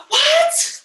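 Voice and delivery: high shocked what; surprised. Real, not acted. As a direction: squeak in mock disbelief. Mock shock at silence.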